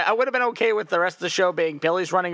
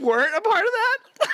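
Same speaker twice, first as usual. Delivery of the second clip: much higher, mock-incredulous question; 13 semitones higher than usual. Co-host questioning the clown in a high put-on voice. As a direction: squeak out the disbelief. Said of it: "we're just making it up".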